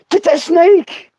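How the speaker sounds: high, drawn-out relative's call; mock urgent order. Relative running past the kid in the garden, mock urgent order. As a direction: send the kid off with a high holler.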